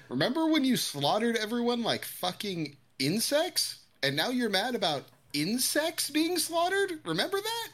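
very high, mocking, rising jab; sarcastic Mark. Mark taunting Omni-Man about insects, high mocking voice. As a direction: taunt him with it.

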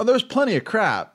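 high, loud scoffing protest; incredulous exclamation. Real, not acted. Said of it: "They were churning out less crap".

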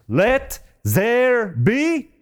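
each word drawn out high, "Let ... there ... be"; slow, ringing decree on "Let there be"; emphatic command. Retold or imitated. God commanding creation in Genesis, emphatic "Let there be". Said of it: "It was very emphatic".

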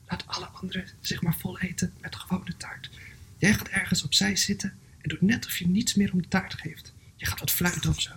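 hushed conspiratorial whisper; softly spoken. Acted. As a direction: share a secret plan under your breath. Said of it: "zei de mier zachtjes".

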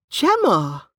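put-on high, shocked young woman's protest; scandalized gasp. A narrator's reading. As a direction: exclaim her name, scandalized and high. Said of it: "Lana gasped".

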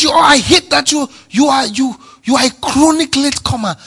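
high, loud hammed-up shout of an irritated boss; mock angry boss. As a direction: bark it like a fed-up boss scolding a latecomer. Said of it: "If he says".

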